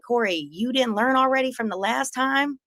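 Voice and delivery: mocking taunt. Taunting Corey in the street.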